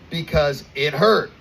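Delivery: usual speaking voice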